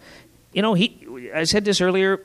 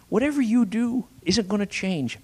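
assuring father; 7 semitones higher than usual. Acted. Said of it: "he assures him of his love".

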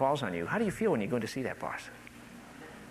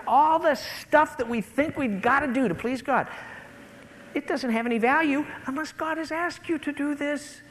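high, impassioned sermon delivery; full-force preaching; 11 semitones higher, 4 dB louder than usual. Real, not acted. Preacher telling believers performance has no value, impassioned. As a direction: build the list urgently, pitch held high.

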